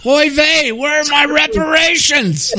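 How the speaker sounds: high, shouted hammed-up Yiddish accent. Mock caricature voice.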